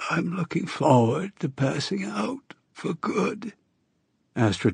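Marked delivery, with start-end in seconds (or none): 0.1-3.5 a dying woman's rasping plea; hoarse voice. A narrator's reading. Narrator voices dying Astrid.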